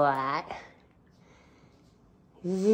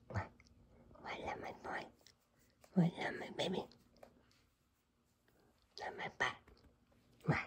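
soft whispered pet talk; 15 dB quieter, much faster than usual. Real, not acted. Cooing to cats in whispers.

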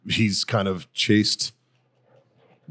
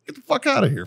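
high mock shout; retold brush-off, pitched high; mock angry shout. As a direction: shoo her off, hammed up. Retelling telling off a rude woman.

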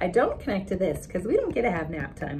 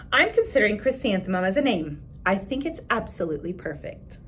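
put-on expressive read-aloud for children; story narration; 3 semitones higher, 4 dB louder than usual. A narrator's reading. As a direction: read the picture book to the class with voices. Reads picture book aloud.

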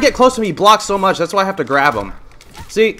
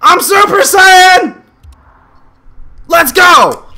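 loud high triumphant yelling; hyped shouting; 8 semitones higher, 7 dB louder, slower than usual. Real, not acted. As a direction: roar in triumph like a hero.

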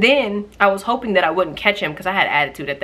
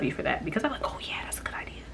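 whisper; 3 semitones lower, 11 dB quieter, faster than usual. Real, not acted. Grateful aside, whispered.

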